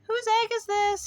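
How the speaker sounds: high, calling voice. A narrator's reading. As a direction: call out to whoever owns it. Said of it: "he called".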